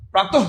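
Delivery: "প্রাতঃ" ending in an audible h; বিসর্গে হ উচ্চারণ. Shown as a demonstration. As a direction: say the visarga of "প্রাতঃ" as h. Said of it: "বিসর্গের পরিবর্তে আমরা হ উচ্চারণ করব". ("প্রাতঃ"-এর বিসর্গ হ-এর মতো বলা)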